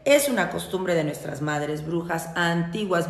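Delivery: ordinary talk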